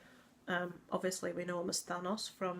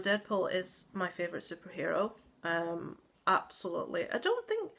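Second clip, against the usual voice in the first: normal talking voice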